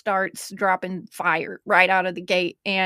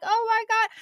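much higher, half-wailed mock dismay; 13 semitones higher than usual. Host reliving seeing her mistake, high dismay. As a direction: act the sinking realization. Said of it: "I click on it and I'm like".

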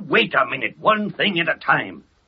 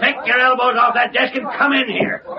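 barked order; 7 semitones higher than usual. Acted. Editor orders reporter in.

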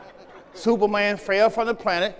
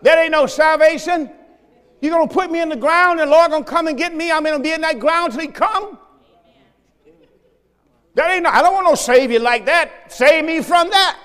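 loud, high, fired-up preaching; preaching shout; 6 semitones higher, 5 dB louder than usual. Real, not acted. Preacher shouting down false teaching to the church, loud and high. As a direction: shout it out to the congregation.

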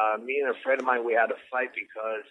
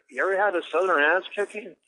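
higher, louder Southern twang; 8 semitones higher, 5 dB louder than usual. Retold or imitated. Bragging to friend about a street fight, Southern twang. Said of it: "a Southern twang came out".

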